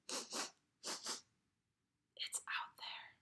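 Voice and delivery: soft whisper of wonder; the pig whispers to himself, dreamy; awed whisper. Acted. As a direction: breathe it out in awe. Pig sensing the forest smell.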